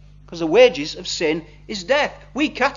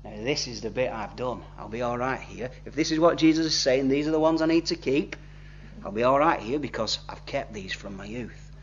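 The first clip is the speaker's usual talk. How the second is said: lower, softer self-satisfied murmur, with a smile; quietly pleased with himself, sure he has passed; smug inner thought; 8 semitones lower, 8 dB quieter than usual. Retold or imitated. Rich young man thinking to himself as Jesus lists commandments, lower and softer, smug. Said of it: "a little smile starts to appear on the man's face because he thinks".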